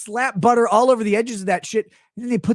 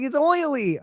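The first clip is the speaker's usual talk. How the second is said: high-pitched whiny kid imitation; put-on tearful complaint; mock crying; 7 semitones higher, slower than usual. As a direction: whine it like an upset child. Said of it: "They're, like, crying about the butter".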